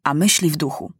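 usual speaking voice

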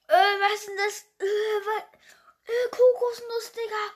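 half-crying in disgust; mock sobbing complaint; whiny fake crying. Real, not acted. Forced to eat hated coconut.

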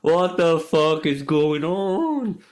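slow, drawn-out mock disbelief; retold baffled outburst. Retelling the double booking, slow and baffled. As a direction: stretch it out, baffled by the two flights. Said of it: "And I was like".

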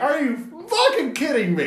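high, loud, laughing disbelief; incredulous outrage. Retold or imitated. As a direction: cry out in mock fury. Acted reaction to ruined ending.

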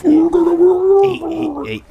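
very high, loud squeaky hey; squeaky monkey calls. Acted. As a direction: shriek it like a little monkey. Monkey calling out.

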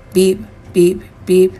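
short, evenly spaced beeps held on one pitch; imitated alarm beeps. A narrator's reading. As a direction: mimic a timer going off, beep by beep. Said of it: "some sort of timer going off".

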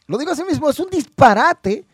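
high-pitched, emphatic indignation; indignant outburst. Real, not acted. Host standing by his harsh verdict, high indignant outburst. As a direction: let the voice leap up in outrage. Outraged at the booking.

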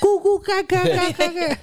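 put-on high babbling baby voice; mocking baby talk. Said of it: "do you ever see a seven-year-old who's like".

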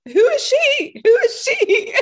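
high, loud, half-laughing "who is she"; shocked Roxana. Retold or imitated. Roxana gawping at Jackie coming out, high and loud. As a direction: yelp it out as if a stranger just walked in. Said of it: "Roxana who's just like".

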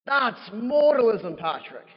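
loud, high, drawn-out Irish leprechaun outcry; bad Irish accent. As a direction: scold in a hammed-up Irish accent. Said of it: "my terrible Irish accent".

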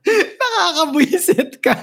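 high laughing through the words. Real, not acted. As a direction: laugh while scolding. Laughing after co-host teases.

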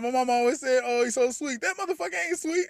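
very high cartoonish mock voice; mock movie character. Retelling the brothers' argument to friends, high mocking squeal. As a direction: squeal it out, hamming up the scene. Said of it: "He's like".

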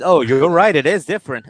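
loud, high-pitched sarcastic agreement; mock agreement. Mother answering her daughter's excuse, loudly sarcastic.

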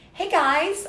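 mocking a kid YouTuber intro; YouTuber greeting. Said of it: "they're like".

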